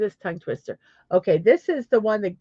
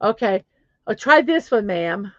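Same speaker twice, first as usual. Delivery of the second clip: ordinary talk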